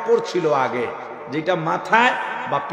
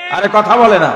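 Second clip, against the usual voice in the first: loud rhetorical call; 3 semitones higher, 10 dB louder than usual. Real, not acted. Preacher's catchphrase to crowd.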